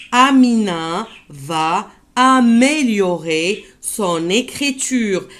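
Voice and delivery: slow, linking son écriture as son-nécriture; teacher repeats son écriture slowly, sounding the liaison. Shown as a demonstration. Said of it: "Je répète".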